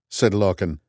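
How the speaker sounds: usual speaking voice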